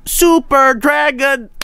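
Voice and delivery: high, slow drawn-out chant of the name; fan yelling. Retold or imitated. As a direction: call it out like a fan in a big arena. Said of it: "fan in the crowd yelling".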